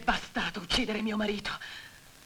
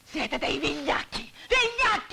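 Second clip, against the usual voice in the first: building to a shout; 4 semitones higher, slower than usual. Acted. Widow calling the men cowards, bitter to screaming. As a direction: spit out cowards, then scream it.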